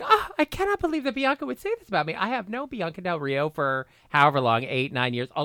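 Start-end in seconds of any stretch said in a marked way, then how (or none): 0.0-2.1 high, rapid retold disbelief; mock taken aback. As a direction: act out being shocked and offended. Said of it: "I was at first taken aback".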